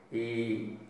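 usual speaking voice

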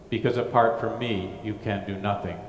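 normal talking voice

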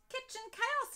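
high, loud exclamation of dismay; exclaiming. Acted. Mum discovers the flour-covered kitchen, high exclaiming. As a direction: cry it out like a shocked mum. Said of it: "exclaimed Mum".

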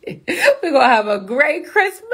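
laugh, then stretch it out almost into song; gleeful high Christmas cheer; giddy singsong. Real, not acted. Repeats it laughing, breaking into song.

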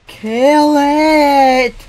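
loud high yell; shout. Real, not acted. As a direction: yell it out urgently. Shouting at a mob.

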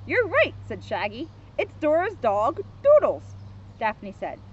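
measured, lively storybook narration; children's story reading. A narrator's reading. Officer reads Scooby book to kids, storybook narration. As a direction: read it out clearly for small listeners.

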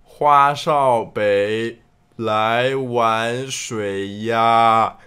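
very slow, low, stretched reversed syllables; backwards speech. Acted. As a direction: drag it out like reversed audio. Guessing game imitating reversed audio. (极慢倒放腔)

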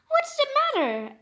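high, concerned asking; George asking. Acted. George asking the crying fox, high and concerned.

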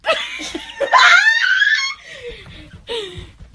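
high shrieking laugh, then falls. Real, not acted. Huge laugh after song.

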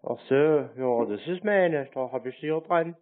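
ordinary talk

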